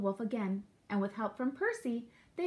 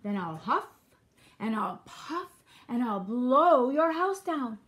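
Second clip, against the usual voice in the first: loud, swelling wolf threat; big storybook villain bluster; threatening wolf; 7 dB louder than usual. A narrator's reading. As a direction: build to a long, loud blow.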